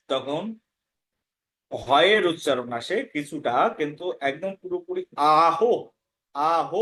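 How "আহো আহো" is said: "আহ" drawn out into a full "aa-ho"; wrong visarga. Shown as a demonstration. Teacher showing what not to say, "আহ" as aa-ho. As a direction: give "আহ" a whole second syllable, ho. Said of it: "আহ উচ্চারণ করা যাবে না".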